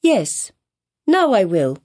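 put-on high, eager little girl; child's answer. A narrator's reading. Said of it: "answered the child".

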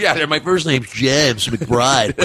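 slow, careful, articulate introduction. Retold or imitated. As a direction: say it slowly in an imitated well-spoken voice. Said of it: "articulate people like that talk like".

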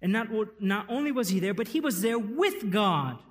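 loud, high, fast proclamation; full-force preaching. Acted. Pastor proclaiming to the congregation, loud and driving. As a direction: drive the point home.

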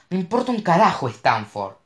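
high, loud tearful shout; shouting in tears. A narrator's reading. Mo shouts tearfully at Cash about Stanford. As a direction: cry it out furiously. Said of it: "gritó llorando".

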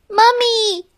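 put-on high, childlike call. A narrator's reading. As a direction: call out for Mommy. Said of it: "she called".

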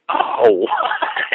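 high-pitched exaggerated gasp of shock; huge shocked reaction. Man re-enacting shock at her age, exaggerated disbelief. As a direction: blow up the surprise, voice leaping up. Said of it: "I made a huge".

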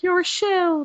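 high-pitched mocking accusation; accusing crowd. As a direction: mimic a whiny accuser in a high voice. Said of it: "everyone's gonna be like".

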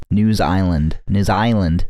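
"News Island" with Kiwi vowels; New Zealand accent. Shown as a demonstration. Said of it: "in that accent".

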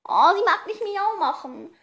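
high, slow pout; playful pouting lament. Real, not acted. Owner whose cat will not meow, pouting. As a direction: draw it out in cooing disappointment.

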